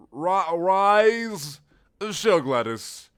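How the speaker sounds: ordinary delivery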